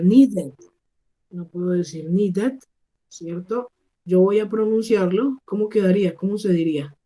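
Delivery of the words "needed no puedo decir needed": NEEDED said as "nee-det", full E; wrong ED ending. Shown as a demonstration. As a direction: read "needed" as spelt, with a Spanish E. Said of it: "yo no puedo decir".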